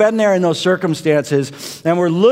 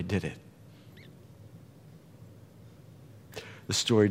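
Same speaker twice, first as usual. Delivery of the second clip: hushed preaching; 8 semitones lower, 8 dB quieter than usual. Real, not acted. Preacher softly telling the congregation of Friday and Sunday. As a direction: draw them in with a hush.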